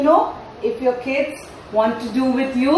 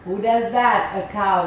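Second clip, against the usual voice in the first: ordinary delivery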